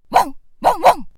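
high, loud dog barks; barking. Acted. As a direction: bark like three eager dogs. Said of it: "と吠えました". (高く大きな犬の鳴き声)

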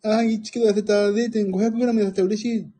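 lightly voiced bubbly young girl; a hint of a chirpy teen vlogger. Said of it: "若い子なんかさ". (若い女の子を軽く真似た声)

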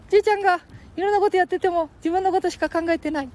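put-on high squeaky boy voice; Ken-chan voice.

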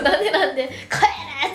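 half-laughing as she replays yelling at staff; retold angry yell. Recalling yelling at hotel staff at checkout, laughing mock yell.

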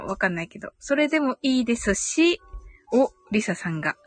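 usual speaking voice

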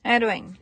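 "How are you doing" mumbled into "aru-en"; dropped H. Shown as a demonstration. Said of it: "もう言わずに".

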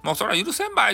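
a hint of indignant villager; stern refusal. Villagers refusing Hikoichi's apology, high indignant.

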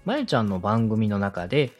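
ordinary delivery

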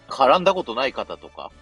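normal talking voice

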